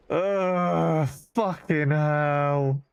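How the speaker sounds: long, drawn-out exasperated groan; weary drawn-out swearing; despairing groan. Real, not acted. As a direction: groan the curse out very slowly. Groaning at own wasted time.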